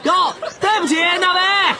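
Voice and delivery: high, called out over laughter; calling out. Acted. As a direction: call out an apology. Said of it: "喊道". (在笑声中喊道)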